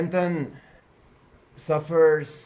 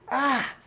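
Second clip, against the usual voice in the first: mock yell; 10 semitones higher than usual. Woman caught in quicksand, high acted yell. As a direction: cry out high as the character. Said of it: "Arisa yells".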